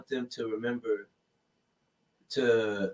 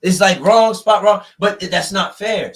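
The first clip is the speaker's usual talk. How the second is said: indignant; 6 semitones higher, 7 dB louder than usual. Real, not acted. Indignant about unfair arrests.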